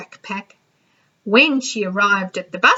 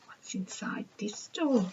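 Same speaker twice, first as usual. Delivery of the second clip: hushed secret whisper; 14 dB quieter than usual. Acted. Reading to children at a door flap, whisper. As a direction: whisper as if peeking in. Reader peeks behind flap.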